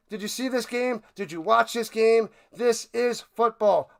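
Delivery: usual speaking voice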